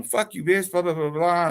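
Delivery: high-pitched mock tirade; retold angry outburst. Caller cursing out dispatcher before hanging up, mock angry. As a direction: rattle off a retold cussing-out.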